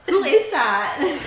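high, slow, loud astonished question; surprised. Retold or imitated. Retold reaction to husband's phone voice.

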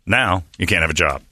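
ordinary talk, nothing marked

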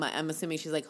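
ordinary talk

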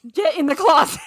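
exasperated order breaking into laughter; mock urgent order. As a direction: order someone to hide, half laughing. Retold order into the closet.

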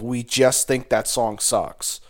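ordinary talk, nothing marked

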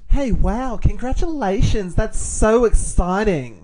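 high, gushing mock girl voice. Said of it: "Can you be the girl voice?".

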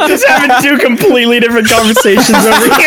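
laughing while apologizing; laughing apology. Real, not acted. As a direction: giggle through the apology. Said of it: "laughing apology mid-chaos".

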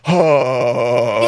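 long held vocal warm-up "haa"; imitated voice drill, sustained; voice exercise. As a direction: hold a mock warm-up note. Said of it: "doing voice exercise".

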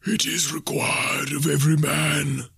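high, slow spectral intoning; mournful ghost. A narrator's reading. Ghost answering Scrooge's question, mournful intoning. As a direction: pronounce a doom from beyond.

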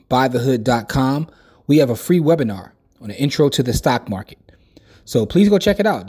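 loud, upbeat promo read into the mic; podcast promo read. Acted. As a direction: sell the free webinar like a radio spot. Host reads promo intro.

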